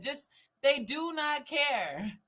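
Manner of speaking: usual speaking voice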